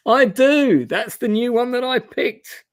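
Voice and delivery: high, loud burst of delight; real excitement. Real, not acted. Player finds his new skill pays off, real high excited outburst. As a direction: exclaim it high, thrilled at the lucky pick.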